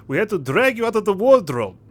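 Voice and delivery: put-on heavy Russian-accented sailor voice. Sailor to the stowaway he found, in a Russian accent. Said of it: "Sorry for my abrupt accent change".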